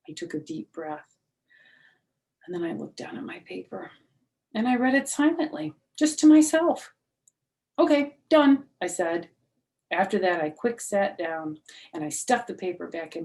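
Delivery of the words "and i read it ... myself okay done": put-on much higher, louder hurried little girl; chirpy child. Junie B pretending to read, high and loud. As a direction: rush it like a fibbing kid.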